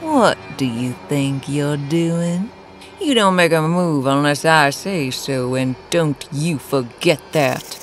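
high, soft, slow purr. A narrator's reading. Woman boss scolding her paralyzed lackey, purring. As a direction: purr a menacing reprimand.

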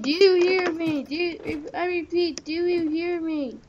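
ordinary talk

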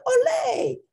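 high excited shout from the pack; shouted cheer. A narrator's reading. Amigos cheering the landing, high excited shout. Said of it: "shouted his amigos".

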